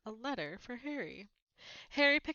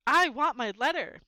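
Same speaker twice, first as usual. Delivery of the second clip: loud, higher shout; 5 semitones higher, 10 dB louder than usual. A narrator's reading. Harry shouting at his uncle for his letter, loud. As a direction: yell it. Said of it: "he shouted".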